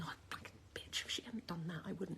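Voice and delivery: muttered curses under the breath; soft seething whisper; whispered resentment. Retold or imitated. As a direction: whisper it like a bitter thought. Resentful inner voice quoted.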